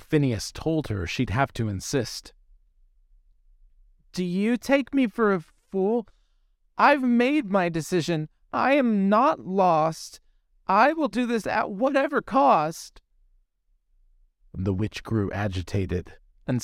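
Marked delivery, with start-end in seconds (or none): 4.1-13.0 put-on high, firm girlish voice, slowing on "I am not lost"; determined young mermaid. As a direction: stand your ground, high and young. Mermaid defiant before the witch.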